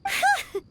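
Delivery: short high nervous laugh; relieved laugh. Acted. Paimon relieved Cloud Retainer isn't offended, nervous laugh. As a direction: laugh off the worry.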